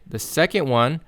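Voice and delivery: normal talking voice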